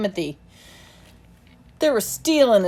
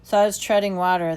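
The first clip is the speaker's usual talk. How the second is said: ordinary delivery, nothing marked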